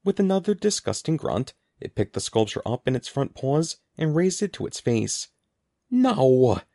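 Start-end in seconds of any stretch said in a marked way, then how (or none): 5.9-6.6 sudden loud no; a burst of alarm; cry out. A narrator's reading. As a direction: blurt it out in alarm. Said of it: "Evan cried".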